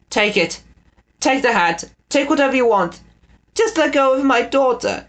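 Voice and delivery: high pleading call. A narrator's reading. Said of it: "he called".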